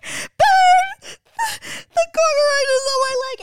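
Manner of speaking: high, loud sobbing wail; sobbing to dad that the bug is on her leg; crying. Retold or imitated. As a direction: cry it out in terror, voice breaking. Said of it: "I'm like".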